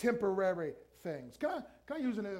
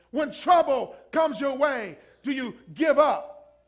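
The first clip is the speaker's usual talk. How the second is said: loud, raised preacher's delivery; forceful preaching; 4 semitones higher, 9 dB louder than usual. Real, not acted. Preacher challenging the congregation to stand firm, forceful and loud. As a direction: punch out the challenge at full voice. Preacher driving home exhortation.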